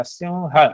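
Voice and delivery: सिंह said as "simha"; correct pronunciation. Shown as a demonstration. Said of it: "सिंह नहीं होता". (सिंह का शुद्ध उच्चारण सिम्ह)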